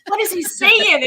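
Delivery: loud, high, eager imitation; excited. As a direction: demand it like a thrilled client. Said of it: "he's so excited to hear".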